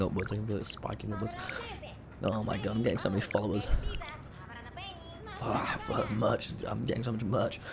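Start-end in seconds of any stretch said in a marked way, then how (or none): none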